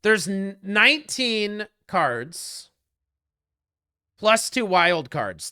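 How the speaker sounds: slow, deliberate spelling out; patient slow re-explanation; slow explaining. Real, not acted. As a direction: lay it out slowly for a confused viewer. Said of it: "Can I explain that again?".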